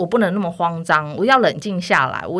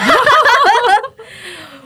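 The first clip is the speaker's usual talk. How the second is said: loud high laughter, falling away; 8 dB louder than usual. Real, not acted. Guest laughing at host's joke about leaving, loud high laugh.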